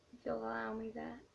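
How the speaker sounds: ordinary talk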